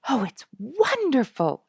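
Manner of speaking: high, loud delighted cry; a girl thrilled by the wild heron colony; excited cry. A narrator's reading. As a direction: burst out with childlike wonder. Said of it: "she cried".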